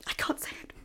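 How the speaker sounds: whispering. Acted. Too shocked to say it.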